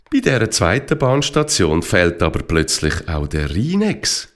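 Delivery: ordinary delivery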